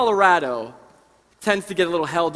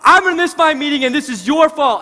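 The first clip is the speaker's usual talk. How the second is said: angry shout; 9 semitones higher, 11 dB louder than usual. Retold or imitated. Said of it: "this guy comes up and he goes".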